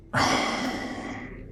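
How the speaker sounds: long voiceless sigh of dismay; long, airy sigh; exasperated sigh. Acted. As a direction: breathe out hard at the setback. Signpost is missing.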